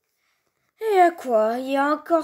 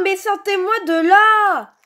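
ordinary talk, nothing marked

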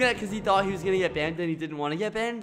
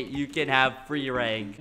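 usual speaking voice

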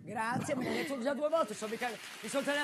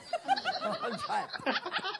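cackling laugh. Acted. Mock caller bit.

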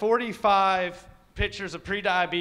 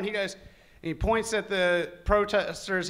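normal talking voice

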